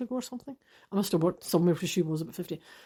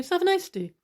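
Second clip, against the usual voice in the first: a bright, higher sign-off; higher, louder farewell, a little mocking; cheery goodbye; 10 semitones higher, 3 dB louder than usual. Quoting the friendly stranger's parting words, bright and higher.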